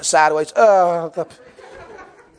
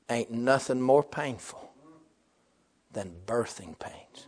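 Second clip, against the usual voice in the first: softer, slower, intimate emphasis; hushed repeat; 5 semitones lower, 12 dB quieter than usual. Real, not acted. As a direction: drop to a near hush so it lands.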